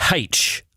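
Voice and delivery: "H" said as HAITCH; letter "H" with an h in front. Retold or imitated. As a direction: sound an aspirated h at the start of "H". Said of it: "people that say".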